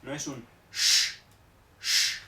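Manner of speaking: "sh" fuerte y soplado. Shown as a demonstration. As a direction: sopla la "sh" con fuerza. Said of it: "no es tan fuerte como la S H". ("sh" más fuerte que la x)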